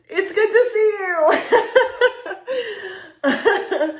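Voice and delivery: high, loud, laughing squeal; overjoyed greeting. Acted. Greeting an old friend after years apart, overjoyed. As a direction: gush it loudly through excited laughter.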